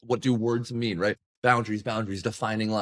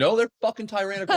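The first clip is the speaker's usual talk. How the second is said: high, fast mock insistence; emphatic retort; 11 semitones higher, 4 dB louder, faster than usual. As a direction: fire back an exasperated correction. Acted argument over a study.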